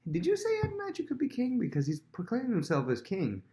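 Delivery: high, overly innocent questioning; feigned innocence. Retold or imitated. Nathan to the king, feigned innocent question. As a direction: act as if you know nothing, voice up high. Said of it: "comes in as if he doesn't know".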